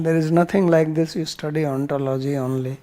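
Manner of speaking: ordinary delivery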